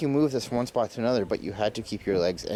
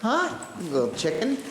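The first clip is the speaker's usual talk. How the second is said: mock scolding dad; 10 semitones higher than usual. Mock scolding over a remark.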